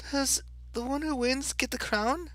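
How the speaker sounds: high-pitched little Alice voice; Alice, polite. Acted. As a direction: answer in a high girlish Alice voice.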